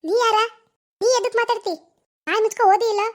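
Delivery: loud, high defiant comeback; angry retort. Acted. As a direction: throw it back at her. Sister snaps back in quarrel.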